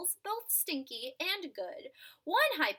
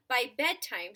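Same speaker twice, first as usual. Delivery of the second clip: ordinary delivery, nothing marked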